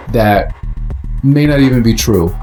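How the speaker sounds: ordinary delivery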